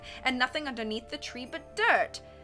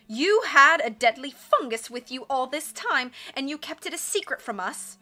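accusing outburst; 4 semitones higher, 6 dB louder than usual. A narrator's reading. Leader confronts the orphans over a kept secret, indignant.

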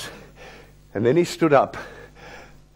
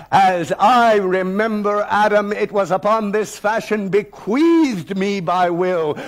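slow, louder, commanding recital; thundering defiance; 5 semitones higher, 3 dB louder than usual. Retold or imitated. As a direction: declaim it like a challenge. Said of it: "I read with thunder and assurance and command".

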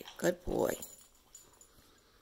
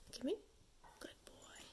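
whispered praise; whispering; 5 semitones higher, 18 dB quieter than usual. Real, not acted. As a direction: breathe it softly to the dog. Praising a dog.

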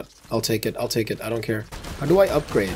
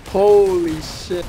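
slow drawn-out stunned curse; 2 semitones higher, 4 dB louder, much slower than usual. Real, not acted. Gamer realizing he aggroed everything, slow stunned curse. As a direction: let it sink in slowly.

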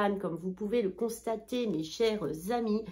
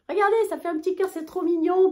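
high, fast gushing over a cute heart shape; real excitement; 10 semitones higher, 6 dB louder, faster than usual. Real, not acted. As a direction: squeal with real delight. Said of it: "là je suis en extase".

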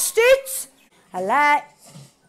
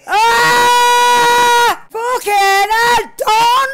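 pained shout; 3 semitones higher, 4 dB louder, much slower than usual. Real, not acted. Gamer watching his car crash, loud dismayed shout. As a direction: howl it at the screen as the car goes wrong.

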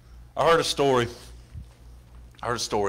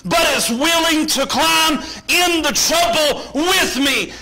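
high, loud, shouted testimony; full-force preaching; 12 semitones higher, 6 dB louder, slower than usual. Real, not acted. Preacher testifying to God's help in trouble, full-force preaching. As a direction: preach it at the top of your voice, driving each phrase.

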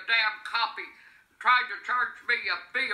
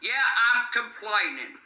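normal talking voice

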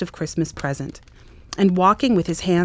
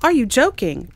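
higher-pitched, incredulous question; boy's exclamation; 6 semitones higher, 4 dB louder than usual. A narrator's reading. Boy told to buy the turkey, incredulous exclamation. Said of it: "Exclaimed the boy".

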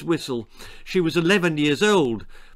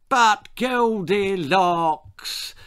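high, very slow, cooing mother; simpering; 7 semitones higher, 3 dB louder, much slower than usual. A narrator's reading. Mother coaxes her Goldilocks, simpering. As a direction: draw it out in a simper. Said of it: "simpered her mother".

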